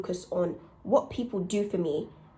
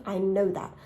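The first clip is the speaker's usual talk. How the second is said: ordinary delivery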